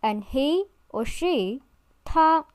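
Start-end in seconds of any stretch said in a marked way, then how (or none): 2.0-2.5 他 in Mandarin first tone, high and level. Shown as a demonstration. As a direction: hold 他 high and flat. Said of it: "the first tone".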